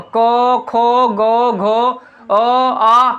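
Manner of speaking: को, खो, गो, घो, ओ, आ sounded out one by one from the throat; throat sounds. Shown as a demonstration. Teacher showing where the sound comes out, guttural articulation. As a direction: place each of को, खो, गो, घो, ओ, आ in the throat. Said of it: "यहाँ से निकल रहा है".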